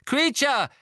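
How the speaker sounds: loud, high-pitched demand; urgent call. A narrator's reading. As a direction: shout after the elf for an answer. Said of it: "rief Harry dem Elfen nach".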